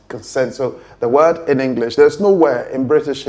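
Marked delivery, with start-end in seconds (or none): 0.1-0.5 Consent with stress on sent, schwa first; correct stress. Shown as a demonstration. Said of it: "pronounced as consent".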